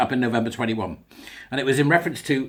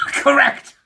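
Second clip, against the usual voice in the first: very high, loud mock verdict; hammed-up quiz-host shout; Mastermind quizmaster; 13 semitones higher, 7 dB louder than usual. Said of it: "rather like Mastermind".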